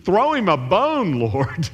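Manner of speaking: high, half-joking plea to God; mock-begging prayer, pitched high; pleading listener. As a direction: wheedle the Lord for a bone. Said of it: "you're hoping for some".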